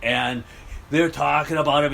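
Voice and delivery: ordinary delivery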